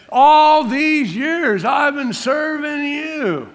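high, loud, drawn-out mock grievance; hammed-up wail of the unappreciated son; resentful complaint. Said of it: "in the tone of what he's saying".

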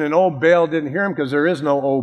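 usual speaking voice

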